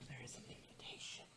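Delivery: breathy whisper into the mic. Acted. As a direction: whisper it like a secret. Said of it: "I was whispering sweet nothings".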